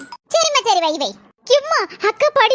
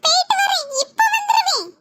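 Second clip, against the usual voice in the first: eager calling; 6 semitones higher than usual. Acted. Kids calling friend to play.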